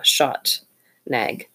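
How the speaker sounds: usual speaking voice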